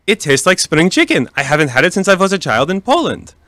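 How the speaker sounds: a hint of a Yiddish-Polish grandma; Polish grandmother accent. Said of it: "You do that accent really well".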